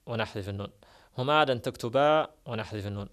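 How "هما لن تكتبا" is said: تكتبا said loudly with the final ا clear, no نون; loud model reading of تكتبا; تكتبا spoken out with the نون dropped; stressed example. Shown as a demonstration. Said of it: "أقول".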